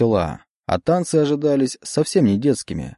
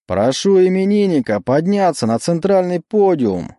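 high, showy host announcement; emcee announcing; 7 semitones higher than usual. Acted. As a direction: call it out like a club emcee. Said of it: "не унимался конферансье".

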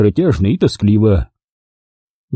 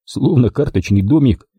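plain reading voice

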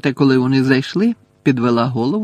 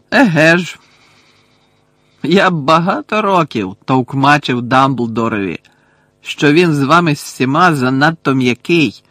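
nasty snickering; 3 semitones higher, 6 dB louder than usual. A narrator's reading. Caretaker mocking Harry about Dumbledore's softness, nasty snickering. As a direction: sneer it loudly with nasty glee. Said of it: "огидно захихотів Філч".

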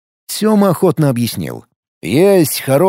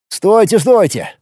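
pleading; 7 semitones higher, slower than usual. A narrator's reading. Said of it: "взмолился Тарас".

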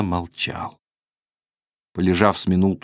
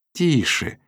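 higher, half-asleep hush; sleepy; 6 semitones higher than usual. A narrator's reading. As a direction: murmur it through sleep. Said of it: "сквозь сон сказала она". (сонно, сквозь сон)

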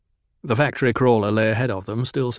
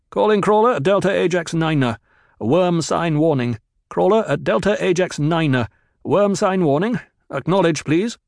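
loud, higher urgent call over the radio; 7 semitones higher, 5 dB louder than usual. Acted. Kynes warning the crawler crew over the radio, loud and urgent. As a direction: broadcast the worm warning so every crawler hears.

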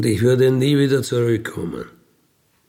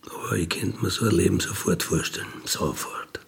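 hushed and quick, then trailing off lower; dreamy whisper; 3 semitones lower, 7 dB quieter than usual. Acted. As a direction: whisper the wish as if to yourself. Träumt leise von Sibirien. (verträumt geflüstert, am Ende tiefer)

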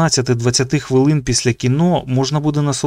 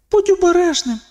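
високий застережливий внутрішній голос; застережи подумки тонким голосом; думка до друга. A narrator's reading. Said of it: "подумала вона для Нікі". (тонкий голос беззвучної перестороги)

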